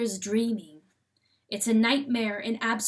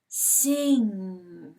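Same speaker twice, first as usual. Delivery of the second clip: a hint of unctuous voice; 2 semitones lower than usual. A narrator's reading. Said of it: "terribly mild and beautiful, and altogether unctuous".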